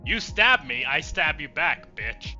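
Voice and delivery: usual speaking voice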